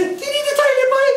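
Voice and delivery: very high Cork singsong; exaggerated high Cork accent. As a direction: imitate Cork relatives at top pitch. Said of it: "That's how Cork people talk".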